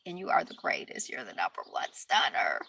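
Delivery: low breathy whisper; soft whispered praise. Real, not acted. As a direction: whisper it like a secret compliment. Whispered affirmation.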